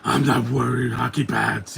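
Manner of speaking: slow, exaggerated throaty growl; mock the masked hero with a gruff rasp; Batman. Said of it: "Yeah, that voice is terrible".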